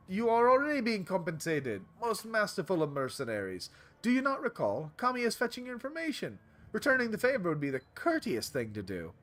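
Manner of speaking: high, flattering vendor voice; ingratiating Turkish vendor. Acted. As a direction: play the courteous shopkeeper gently reproving.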